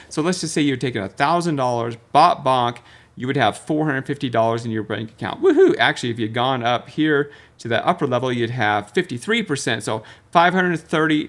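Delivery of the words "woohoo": high, loud playful woohoo; mock cheer. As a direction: whoop like you just cashed out. Cheering imagined profit.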